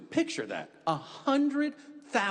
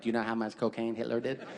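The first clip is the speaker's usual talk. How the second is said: put-on kid voice; 4 semitones lower, faster than usual. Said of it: "be like".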